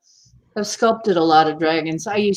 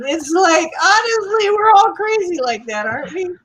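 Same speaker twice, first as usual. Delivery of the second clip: excited; 11 semitones higher than usual. Real, not acted. Gushing over costumes.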